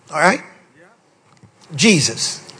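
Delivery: ordinary delivery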